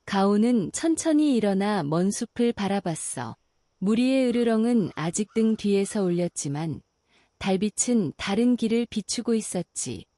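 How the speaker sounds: ordinary delivery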